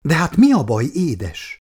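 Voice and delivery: mildly pleading, opening high then dropping. A narrator's reading. Man begging his upset lover to explain, pleading. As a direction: coax her with a gentle plea. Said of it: "fogta könyörgőre Laci".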